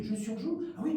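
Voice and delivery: ordinary delivery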